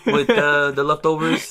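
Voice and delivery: slow, hammed-up Italian accent. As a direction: laugh through a mock Italian accent. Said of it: "like, an Italian accent?".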